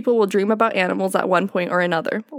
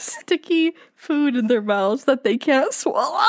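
holding back laughter; 4 semitones higher, slower than usual. Real, not acted. Struggles to read without laughing.

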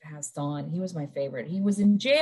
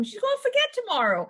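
high breezy reply; 13 semitones higher, 3 dB louder than usual. Retold or imitated. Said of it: "I go".

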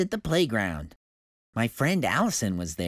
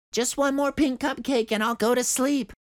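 put-on high, coaxing girl's voice; promising little girl; 12 semitones higher than usual. A narrator's reading. Girl promising her daddy before bed, coaxing. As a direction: wheedle the promise like a small child. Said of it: "I promised".

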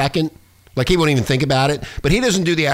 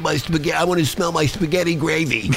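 lightly higher, slowed tough-guy retelling; a hint of a gruff mobster complaining; Henry's voice; 3 semitones higher than usual. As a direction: mimic a crude wiseguy griping about the smell. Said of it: "And he says".

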